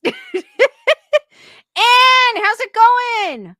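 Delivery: loud, high, drawn-out hail; big singsong hello; hollered greeting. Real, not acted. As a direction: call out to a newcomer, stretching every word. Calls greeting to arriving viewer.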